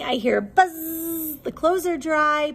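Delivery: ordinary delivery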